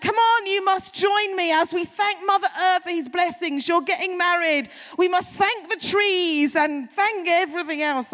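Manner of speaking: high, loud hammed-up urging; eccentric grandmother welcoming. Grandmother inviting Margaret to join her ritual, loud fervent urging. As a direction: beckon her in with mock ritual fervour. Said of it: "the grandmother welcomes her down".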